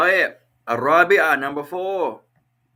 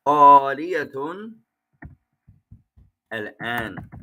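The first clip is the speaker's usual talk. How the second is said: 1 slow dictation. Shown as a demonstration. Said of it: "Make it Arabic".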